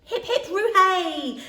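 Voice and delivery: loud, high, slow cheer with a laugh; joyful cheer. Acted. Max cheering to the moon, loud laughing joy. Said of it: "cried Max to the moon".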